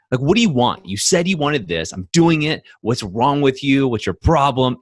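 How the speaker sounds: exasperated husband shouting over the trash; retold angry outburst. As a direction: vent the built-up anger, loud and high. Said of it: "anger and frustration built up so high".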